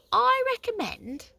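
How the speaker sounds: high, slow whispery dazed box; woozy after being knocked; dizzy voice. A narrator's reading. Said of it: "said the honesty box in a dizzy voice".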